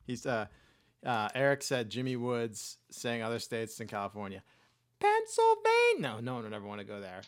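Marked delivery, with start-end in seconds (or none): 5.0-6.0 very high, loud state name; high-pitched Biden parody; Biden impression. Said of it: "He's more high-pitched".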